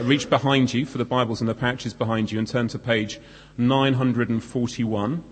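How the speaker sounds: usual speaking voice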